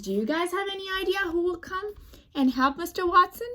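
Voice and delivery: ordinary talk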